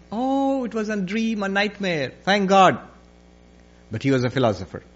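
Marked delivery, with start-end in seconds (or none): 0.1-2.8 higher-pitched sigh of relief after a nightmare; acted "thank goodness" relief; relief on waking. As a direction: exclaim it, relieved to be awake. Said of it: "we would have said".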